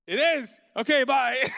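loud and high, laughing through a cheeky exit; laughing retort. Retold or imitated. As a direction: toss it off with a laugh and leave. Defiant retort to a baffled crowd.

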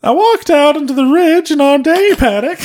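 high, loud put-on character voice; Paul Bear. Co-host reads the witness's words for laughs in a high Paul Bear voice. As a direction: pitch it way up, loud and cartoonish. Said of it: "you stopped doing Paul Bear".

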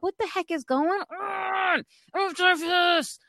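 high, slowed, shouted complaint; exasperated shout. Retold or imitated. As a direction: yell out in disbelief at the noise. Hearing cats fight, exasperated.

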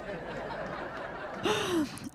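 very soft, airy wheeze of laughter; breathless laugh. Real, not acted. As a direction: laugh almost silently, then gasp. Breaks up after reading fan mail.

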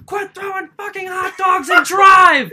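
imitated boss screaming at his driver; high, drawn-out, full-volume shout; furious boss yell. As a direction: bellow it like an irate boss. Boss yelling at driver, retold.

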